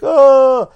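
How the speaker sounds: loud high-pitched "ah"; drawn-out cartoonish holler; mock yell. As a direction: cry out in a silly put-on voice. Troll cries out when told off.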